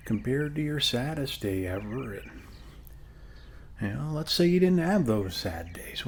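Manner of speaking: normal talking voice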